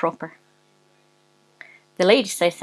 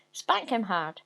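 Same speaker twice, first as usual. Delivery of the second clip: plain reading voice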